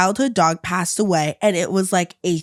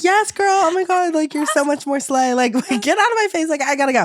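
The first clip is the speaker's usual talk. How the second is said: higher, bright fake-friendly voice; fake cheerful; 9 semitones higher than usual. Reassuring the sobbing roommate, higher fake-cheerful. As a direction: gush insincerely to get away.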